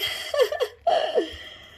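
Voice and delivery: high, airy run of giggles; real laugh. Real, not acted. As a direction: laugh high at your own defeat. Jokes that onions beat her.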